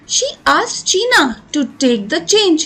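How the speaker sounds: slow, deliberate reading aloud for pupils; slow story reading. A narrator's reading. Teacher reading the story line to students, slow and clear. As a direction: read each word out clearly and slowly.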